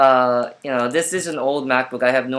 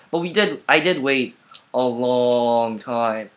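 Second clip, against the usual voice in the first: ordinary talk, nothing marked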